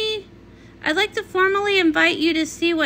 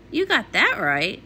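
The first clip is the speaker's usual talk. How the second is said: ordinary talk, nothing marked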